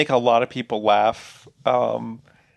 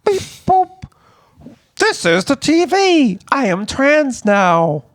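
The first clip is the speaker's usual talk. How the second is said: very high robotic TV voice, slowed down; be the TV announcing itself in a beeping machine voice; talking TV; 10 semitones higher than usual. Pretend.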